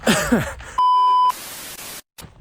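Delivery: bursting high giggle; high laugh. Real, not acted. Laughing at himself.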